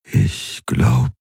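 hoarse, barely a whisper; exhausted, rough whisper; raspy whisper. Acted. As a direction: rasp it out in a breath. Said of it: "kaum mehr als ein Flüstern".

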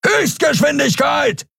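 high, stretched-out bellow; roared command. A narrator's reading. Kero orders the pilot to speed up, roaring. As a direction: bellow the order to the far end. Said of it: "brüllte er quer durch das Schiff".

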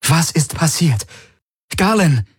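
high, urgent demand; insistent, anxious questioning; demanding, worried. A narrator's reading. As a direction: press for an answer in alarm. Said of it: "Ihre Stimme war fordernd und besorgt".

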